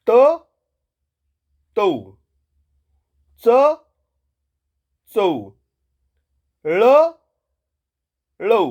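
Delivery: each letter then the letter with औ, punched loud; bare consonant first, then stretch the औ, for the class to repeat; echo drill. Shown as a demonstration. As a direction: clear call-and-response of letter pairs. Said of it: "म्हणा बरं माझ्या मागे, पटापट".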